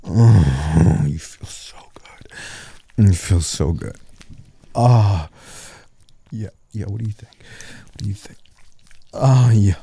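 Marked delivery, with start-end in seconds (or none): 1.0-2.3 slow breathy whisper. Acted. Intimate, said then repeated aloud. 6.8-8.4 whispering. Acted. Teasing question in her ear.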